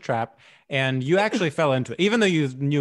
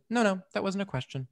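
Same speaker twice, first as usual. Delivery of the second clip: higher-pitched quoted interrogator, lightly acted; a hint of cool screen interrogation, pitched up; Meryl Streep; 6 semitones higher, 3 dB quieter than usual. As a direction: imitate a film inquisitor cutting off an answer. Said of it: "And she goes".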